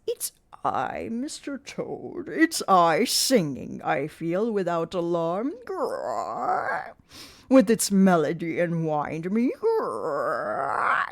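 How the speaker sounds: slow croaking toad with growls. Acted. Mister Toad's voice.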